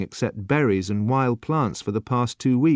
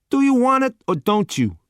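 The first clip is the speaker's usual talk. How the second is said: much higher, louder put-on waiter voice; bored waiter pushing the last dish; gruff waiter; 13 semitones higher, 5 dB louder than usual. A narrator's reading. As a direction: waiter to customer, impatient put-on voice. Waiter impatient.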